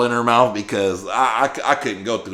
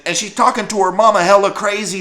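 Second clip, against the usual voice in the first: ordinary talk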